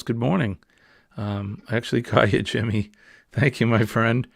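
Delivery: ordinary talk, nothing marked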